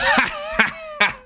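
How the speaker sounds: squeaky high laugh; high cackle. Real, not acted.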